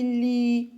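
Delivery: "li" with a long L and a held I; syllable sounded out. Shown as a demonstration. Teacher contrasting syllables for children, "li" drawn out. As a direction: stretch both sounds of "li". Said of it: "pour entendre li".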